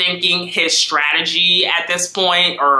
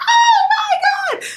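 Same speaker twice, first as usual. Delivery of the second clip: excited squeal. Retold or imitated. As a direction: shriek with delight. Lauren squeals.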